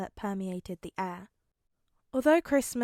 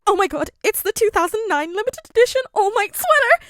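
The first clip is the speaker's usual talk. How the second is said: much higher, loud fan shriek; ecstatic squeal; 12 semitones higher, 13 dB louder than usual. A narrator's reading. Midoriya seeing the All Might sweater, high ecstatic squeal. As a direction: squeal with pure fan joy. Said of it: "high-pitched, inhuman noise".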